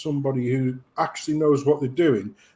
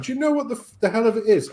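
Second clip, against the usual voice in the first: normal talking voice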